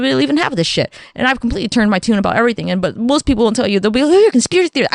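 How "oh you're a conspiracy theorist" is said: high, scoffing mock voice; light mock scoff; mocking dismissal. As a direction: imitate a condescending skeptic. People mocking her beliefs.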